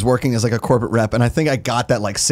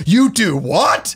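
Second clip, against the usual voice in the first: higher, slowly stretched mock disbelief; retold astonishment; 7 semitones higher, much slower than usual. Guest imitates Chicagoans amazed that he hosts a show, mock astonishment. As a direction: imitate someone stunned, dragging the words out.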